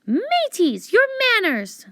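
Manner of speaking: high, prim scold to the pirates; prim librarian scolding. Acted. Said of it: "said Library Lou".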